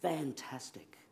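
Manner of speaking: Fantastic said softly with a falling end; soft quoted praise. Retold or imitated. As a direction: hush the word Fantastic down. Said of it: "she said".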